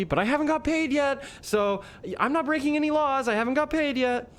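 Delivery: very high, defensive protest re-enacted. Teacher defending himself to the interviewer, acted defensive. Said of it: "defensive and".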